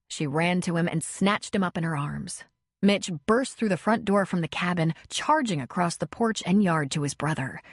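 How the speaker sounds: ordinary delivery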